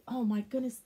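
ordinary delivery

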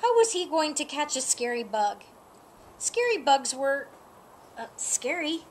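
plain reading voice

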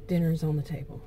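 low, soft, even statement; calm, matter-of-fact. Acted. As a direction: say it softly with no anger or attitude. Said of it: "matter of fact".

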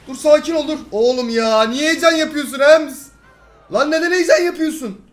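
exasperated scolding. Real, not acted. Gamer scolding panicking teammate Ems, loud exasperated. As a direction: tell him off, loud and fed up.

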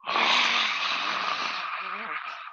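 long breathy exhale, tongue out; lion's breath exhale. Shown as a demonstration. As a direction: breathe out hard like a lion. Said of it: "and roar".

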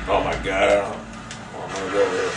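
low-pitched, subdued groan of words; weary dismay. Real, not acted. Gamer not wanting to go there, low dismay. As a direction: sigh it out low, reluctant.

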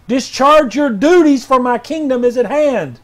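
prophetic proclamation. Acted. Pastor reading R J W's word, loud and commanding. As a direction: thunder it out like a prophet.

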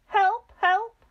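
high, loud shrieks for help; screamed. A narrator's reading. Missus Twit giddy on the ceiling, screaming for help. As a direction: call for help in high panic. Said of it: "screamed Missus Twit".

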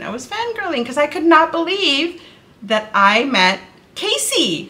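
ordinary delivery

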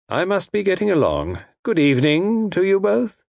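high, courteous villain taking his leave; polite villain. A narrator's reading. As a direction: pronounce doom with elaborate politeness.

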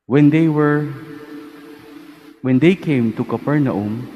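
formal liturgical reading voice; Gospel reading. Acted. Priest proclaims the Gospel reading.

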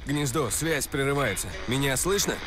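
call dropping out. Acted.

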